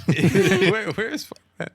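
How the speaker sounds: high laughing landlord echo; laughing mimicry. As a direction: giggle through the landlord line. Echoing the landlord line laughing.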